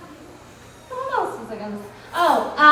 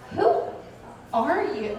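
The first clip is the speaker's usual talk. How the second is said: puzzled paparazzo. Retold or imitated. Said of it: "they're like".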